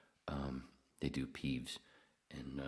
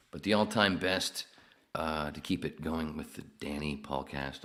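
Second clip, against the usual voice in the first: ordinary talk, nothing marked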